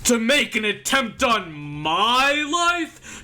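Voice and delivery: high, loud, drawn-out bombast; outraged politician. Acted. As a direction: declaim each affront with theatrical outrage. Voices an outraged pompous politician.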